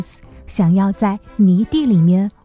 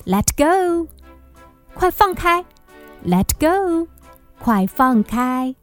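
put-on higher, pleading child; pleading let go; 7 semitones higher than usual. Tug of war with puppies.

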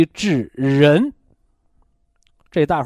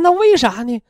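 put-on old lady asking; 8 semitones higher than usual. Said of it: "一个老太太得肺结核还问我".

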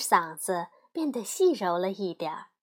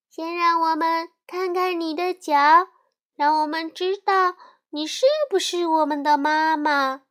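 高声的小羊大声要求; 提高嗓门谨慎地要求看脚; 羊儿们大声道; 5 semitones higher, 7 dB louder than usual. A narrator's reading. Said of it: "羊儿们大声道". (小羊们隔着门大声要求看狼的脚)

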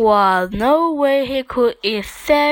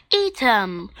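usual speaking voice

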